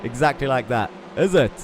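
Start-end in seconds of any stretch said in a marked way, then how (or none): 1.2-1.5 light South African impression, louder; South African accent. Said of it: "spot-on impression".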